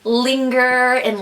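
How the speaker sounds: ordinary talk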